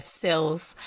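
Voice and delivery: sills, the Kiwi e in cells close to i. Shown as a demonstration. Reader echoing how chat hears her Kiwi cells. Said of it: "as I hear monks sleep in sills".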